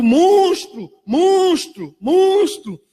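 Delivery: high, loud retold crowd yell; crowd shouting. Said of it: "e todo mundo gritando". (grito da multidão imitado, alto e agudo)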